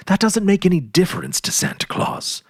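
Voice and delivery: mild read-aloud scoff at a silly worry. A narrator's reading. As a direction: brush the worry aside with a scoff. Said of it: "scoffed Jimmy".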